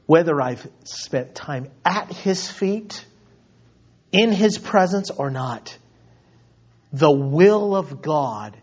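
ordinary delivery

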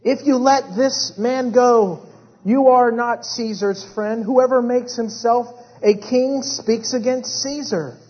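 loud, high crowd accusation; preacher voicing an accusing outcry; retold crying out. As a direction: call it out slowly and forcefully like the crowd.

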